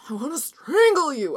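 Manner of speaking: seething threat through the teeth; angry. Retold or imitated. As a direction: mutter a tense mock threat. Commuter rage impulse quoted.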